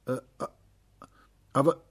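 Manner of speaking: flustered little stammer. A narrator's reading. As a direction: stumble over the first word with gaps. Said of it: "stammelte er".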